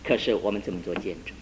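usual speaking voice

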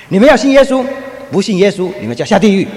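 loud, high shouted warning; preacher's shout again. Retold or imitated. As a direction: bellow the warning at everyone. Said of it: "他再说一次". (大声喊叫)

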